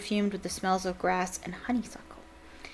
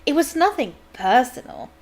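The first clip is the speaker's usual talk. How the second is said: flustered; 4 semitones higher, 6 dB louder than usual. Acted. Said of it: "going very red in the face".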